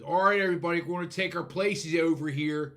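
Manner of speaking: loud bullhorn Philly announcer; bullhorn announcement. Retold or imitated. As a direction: call it out through a megaphone. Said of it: "he's got the bullhorn".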